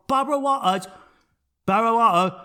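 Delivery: mock slurred "Barbara Walters", consonants falling away; exaggerated speech-impediment imitation of "Barbara Walters". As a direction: say "Walters" as a mushy "Wah-uh". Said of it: "had a stroke on the way".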